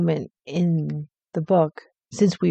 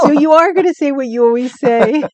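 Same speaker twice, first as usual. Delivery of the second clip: high, loud teasing with stressed ARE; gleeful gotcha on the point of laughing; 7 semitones higher, 9 dB louder than usual. Real, not acted. Co-host pointing out friend's habit, teasing. Co-host teases about pet word.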